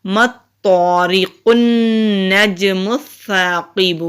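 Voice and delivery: slow, melodic Quranic recitation. Shown as a demonstration. Teacher recites the verse for students, slow recitation. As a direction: intone it slowly and evenly. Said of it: "با پیش بو".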